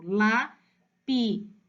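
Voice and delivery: LAPISEIRA broken into la-pi-sei-ra with pauses; syllable by syllable. Shown as a demonstration. Teacher demonstrating how the child read lapiseira, syllable by syllable. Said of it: "Ele leu exatamente silabado".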